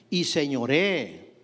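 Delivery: "señoree" drawn out very slowly and weighted; stressed señoree. Shown as a demonstration. Preacher lingering on "señoree" for the congregation, slow and heavy. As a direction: lean on "señoree" and stretch it slowly. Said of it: "recalcamos la palabra señoree".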